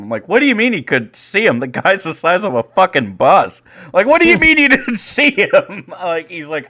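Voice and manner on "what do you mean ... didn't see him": high-pitched, exasperated retort breaking into a laugh; incredulous outburst. Retold or imitated. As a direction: act out disbelief at a lame excuse. Umpire disbelieves the coach's excuse.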